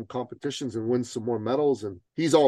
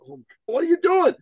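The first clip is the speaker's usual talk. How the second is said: high-pitched mock dismay; exasperated retold reaction; 4 dB louder than usual. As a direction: play up a coach's exasperation. Coach exasperated at client's silly idea.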